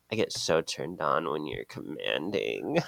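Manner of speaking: low seductive murmur; sultry flirting. Acted. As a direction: breathe it close and low to tempt her. Leo's flirty line voiced.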